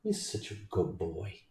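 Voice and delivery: soft, breathy pet praise. Real, not acted. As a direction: whisper sweetly to the dog.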